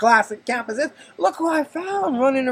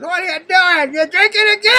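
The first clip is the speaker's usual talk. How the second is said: higher, louder nagging wife; scolding wife; 9 semitones higher, 6 dB louder than usual. Retold or imitated. Wife catching husband with beer, mock scolding. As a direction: catch him out, accusing. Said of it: "She goes".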